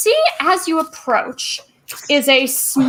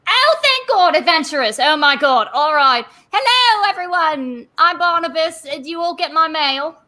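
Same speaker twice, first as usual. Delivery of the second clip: a nervous little constable gushing with relief; Barnabas, relieved; 4 semitones higher than usual. Acted. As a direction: greet the adventurers like rescue has finally come. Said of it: "turns around and sees".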